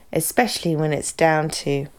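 normal talking voice

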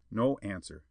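plain reading voice

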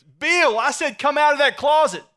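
bossy wife yelling, high and loud; insistent shout. Acted. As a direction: holler the order at someone who is ignoring you.

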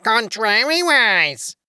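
slow, high drawn-out cry of contrariwise; Tweedledee shouting. A narrator's reading. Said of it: "he would only shout out".

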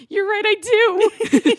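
high, loud put-on whine; mock wail. Bride admitting she wants a big wedding, high mock wail. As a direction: act out a tearful giving-in, hammed up. Retold eloping bride gives in.

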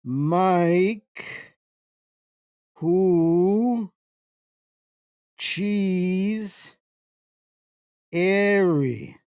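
each word stretched out between long pauses; exaggeratedly slow. Shown as a demonstration. Streamer asking if that's slow enough for you, exaggeratedly slow. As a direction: draw every word out to make a point. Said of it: "is that slow enough for you?".

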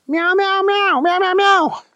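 very high, insistent meows; meowing kitty. Acted. Thunder Kitty answering confused Wendell, insistent meowing. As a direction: squeal out a string of cat meows. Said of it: "said Thunder Kitty".

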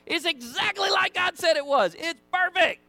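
high, loud, elated exclamation; over-the-top excited shouting; thrilled report. Acted. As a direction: belt it out like astonishing good news. Acting out the spies' thrilled report.

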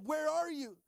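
high, loud call of "Where are you?"; God calling out. Retold or imitated. God calling to Adam and Eve, loud. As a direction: call out searching. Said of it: "God said".

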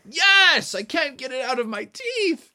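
high, loud burst of frustration; frustrated wail. Acted. Froggy answers his teacher about the stuck fly, frustrated wail.

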